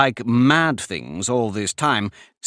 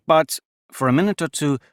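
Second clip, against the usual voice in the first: ordinary delivery, nothing marked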